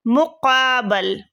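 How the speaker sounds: مقابل stretched out slowly, syllable by syllable; slow dictation. Shown as a demonstration. Teacher to students, slowly dictating the word. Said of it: "اس کا object ہو گیا۔".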